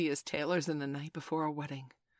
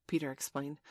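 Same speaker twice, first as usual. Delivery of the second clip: plain reading voice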